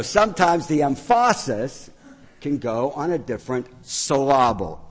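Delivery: "emphasis" as em-FAH-sis, then "syllable" as suh-LAH-bul, on purpose; wrong-syllable stress. Shown as a demonstration. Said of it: "the emphasis and syllables wrong".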